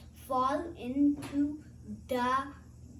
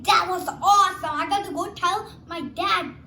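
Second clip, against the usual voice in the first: excited; 5 semitones higher, 10 dB louder than usual. Acted. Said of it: "Spoil like".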